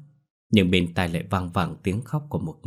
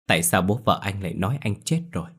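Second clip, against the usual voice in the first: plain reading voice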